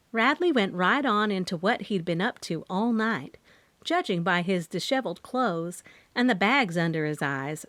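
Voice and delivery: ordinary delivery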